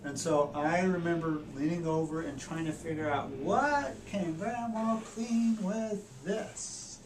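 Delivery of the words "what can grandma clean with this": put-on high, slow, wondering kid voice; slow, high-pitched curious little boy; puzzled child voice. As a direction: squint at it like a curious eight-year-old. Child puzzling over odd stick.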